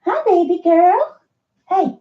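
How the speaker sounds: high sing-song greeting to a pet. Real, not acted. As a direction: coo at the dog in a high voice. Greeting the dog.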